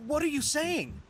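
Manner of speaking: surprised. Acted. Soldier stunned by new order.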